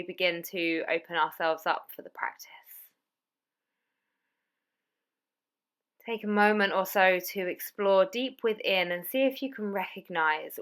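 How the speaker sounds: soft, hushed Yoga Nidra guide voice; calm yoga teacher talking listeners into sleep; guided meditation. Acted.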